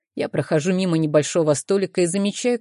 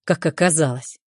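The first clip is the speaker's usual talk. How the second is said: dry. A narrator's reading. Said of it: "сухо добавляет".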